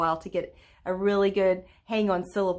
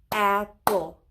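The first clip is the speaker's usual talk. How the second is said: "Apple" split in two beats, a pause between; syllable by syllable; 4 dB louder than usual. Shown as a demonstration. Teacher counting syllables with pupils, "Apple" in two separated beats. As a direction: break "Apple" into two separate, even claps. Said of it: "say the word apple and count the syllables".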